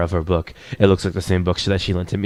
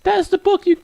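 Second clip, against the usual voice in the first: put-on bookish girl character voice; 4 dB louder than usual. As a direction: say it way up high as the shy girl. Starts line in the girl's voice.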